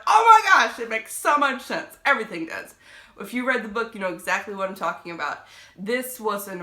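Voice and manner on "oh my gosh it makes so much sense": much higher and louder gasp of realization; the twist finally clicks; sudden realization. Retold or imitated. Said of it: "And then I saw it, I was like".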